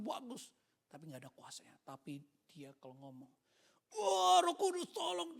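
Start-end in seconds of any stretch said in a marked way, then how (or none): 3.9-5.4 high, loud, drawn-out preacher's plea; impassioned cry. Retold or imitated. Preacher calls on the Holy Spirit for help, loud impassioned cry. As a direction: cry out slowly at full power. Imitates a powerful preacher calling out.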